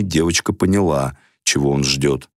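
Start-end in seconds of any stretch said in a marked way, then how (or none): none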